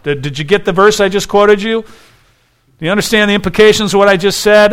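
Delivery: a frustrated rabbi pressing his students; quick, incredulous questioning; exasperated teacher. Retold or imitated. Jesus voiced, exasperated at disciples.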